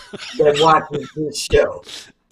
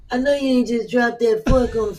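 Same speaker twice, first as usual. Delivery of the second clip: imitating Dennis, higher and scolding; mock-scold like Dennis caught her dropping the fork; 5 semitones higher than usual. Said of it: "Dennis was like".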